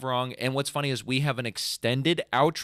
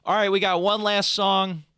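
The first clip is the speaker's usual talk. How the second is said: higher, louder frontman call; stage announce; 8 semitones higher, 5 dB louder than usual. Pretend. Announcing the last song to the crowd, loud.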